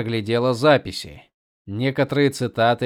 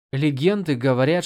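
ordinary delivery

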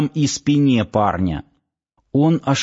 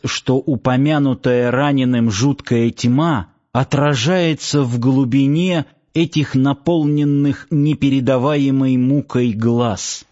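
plain reading voice

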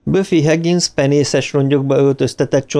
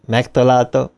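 ordinary delivery

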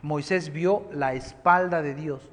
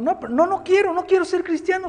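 mechanic's refusal, higher and louder; insistent high refusal; 14 semitones higher, 6 dB louder than usual. Retold or imitated. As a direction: push the no up high and firm. Mechanic refuses the preacher again.

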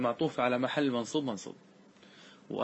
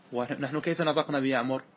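ordinary talk

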